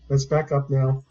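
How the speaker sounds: usual speaking voice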